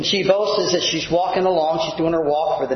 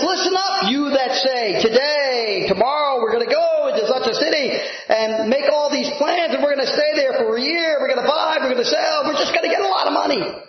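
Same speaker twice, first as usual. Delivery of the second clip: boastful merchant; 7 semitones higher than usual. Retold or imitated. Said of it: "But he says".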